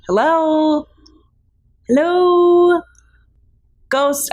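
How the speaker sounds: high, loud calling into emptiness. Pretend. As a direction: call out for the ghost. Calling out into the void.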